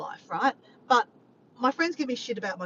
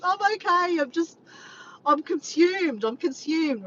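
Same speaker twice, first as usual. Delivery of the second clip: higher, louder exasperated reassurance; overwhelmed reply; 7 semitones higher, 5 dB louder, slower than usual. Retold or imitated. To a worried friend, a higher frantic reassurance. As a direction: pitch it up, a bit frantic.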